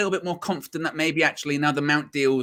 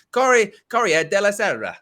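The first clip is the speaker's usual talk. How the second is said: "Corriere della Sera" said high and rolled in an Italian accent; 10 semitones higher, 5 dB louder than usual. Shown as a demonstration. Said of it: "like, an Italian accent".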